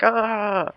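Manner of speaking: high drawn-out "ah", imitated; mock scream. Guy in the Russian lander, mock scream. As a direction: imitate a scream without full force.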